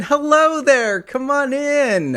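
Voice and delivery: mock welcoming host. Welcoming guest in.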